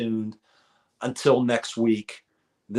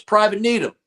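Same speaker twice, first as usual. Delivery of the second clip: barking drill sergeant voice; 11 semitones higher, 7 dB louder than usual. Retold or imitated. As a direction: call out the recruit's name like a drill instructor. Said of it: "he goes".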